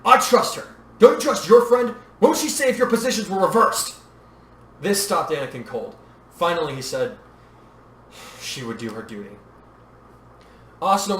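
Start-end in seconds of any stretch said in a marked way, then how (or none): 0.1-3.9 loud, high challenge; heated, raised-voice appeal; shouted argument. A narrator's reading. Shouted argument with Anakin continues. 8.4-9.4 low, soft admission; hushed, lowered voice; subdued concession. A narrator's reading. Subdued answer after being stopped cold.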